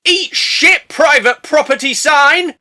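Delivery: loud, high and slow rebel yell; gleeful yell. Acted. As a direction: shout it at the sign like a rowdy teen. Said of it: "and are like".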